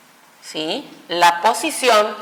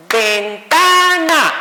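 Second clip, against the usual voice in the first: "ventana" drawn out slowly in three equal beats, higher; clapped-out syllables; 5 semitones higher, 3 dB louder, much slower than usual. Shown as a demonstration. Teacher showing the misleading clapping method, "ventana" in slow beats. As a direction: chant "ven-ta-na" syllable by syllable, as if clapping. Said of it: "Y nos aplaudían, por ejemplo".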